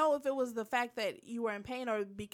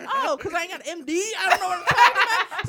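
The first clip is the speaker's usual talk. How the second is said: high, loud sarcastic retort; mock indignation; 6 semitones higher, 8 dB louder, faster than usual. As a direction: act wounded and sarcastic, building louder.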